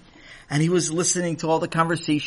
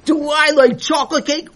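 delighted; 11 semitones higher, 6 dB louder than usual. Acted. Sammy thrilled by the question.

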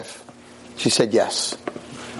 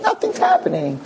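mock indifference. Rabbi showing Scott he doesn't care, mock nonchalant. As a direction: play it cool, pretend not to care.

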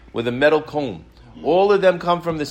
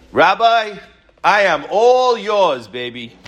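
seductive wife; 4 semitones higher, 4 dB louder, slower than usual. Retold or imitated. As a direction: play a temptress slowly luring the rabbi. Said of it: "I'm gonna go seduce Rabbi Akiva".

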